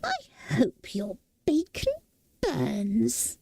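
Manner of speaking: muffled under the pan. A narrator's reading. Fire demon Calcifer curses Sophie from under the pan, muffled. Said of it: "muffled under the pan".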